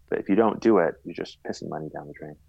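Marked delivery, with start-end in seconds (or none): none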